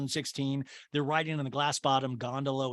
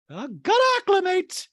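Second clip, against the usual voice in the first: high, loud caricature squeal; Dorit; 6 dB louder than usual. Retold or imitated. Podcaster mimicking Dorit on the mountain, hammed-up squeal. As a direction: push it up into a mock squeak.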